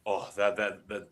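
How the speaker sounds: usual speaking voice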